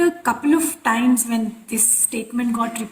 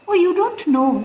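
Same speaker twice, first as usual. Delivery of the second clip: higher, louder imitation of the guru; casual surprise; 7 semitones higher, 5 dB louder than usual. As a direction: toss it off as an innocent, pitched-up question. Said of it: "very casually said".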